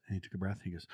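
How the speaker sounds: usual speaking voice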